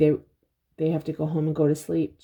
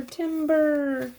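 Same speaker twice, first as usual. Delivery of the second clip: high lumberjack call; calling out Timber; 8 semitones higher than usual. Pretend. Calling out tree falling.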